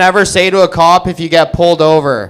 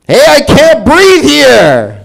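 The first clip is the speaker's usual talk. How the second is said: high, slow, loud New York "Hey" yell; New Yorker impression; 10 semitones higher, 6 dB louder, slower than usual. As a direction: belt it like a cabbie leaning out the window. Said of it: "this is George Floyd if he was from New York".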